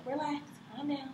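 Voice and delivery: a hushed, mediating tone; voice of reason. Retold or imitated. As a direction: soothe a heated couple gently. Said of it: "Voice of reason".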